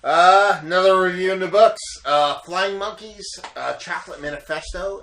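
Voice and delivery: loud, fast show outro; host sign-off. Acted. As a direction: wrap it up like a host.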